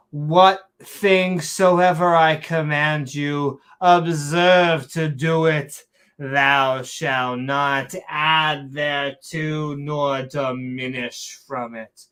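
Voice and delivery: slow, forceful fake-Shakespearean commandment; mock Shakespearean accent. Commanding the people to obey, slow mock Shakespearean accent. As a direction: proclaim it slowly in mock Shakespearean. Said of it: "saying it in a Shakespearean accent".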